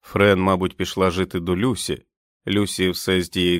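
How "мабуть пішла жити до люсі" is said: plain reading voice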